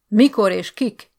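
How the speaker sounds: loud slow interrogation; sharp demand. Acted. Officer interrogating Bálint, loud sharp demand. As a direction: bark it out.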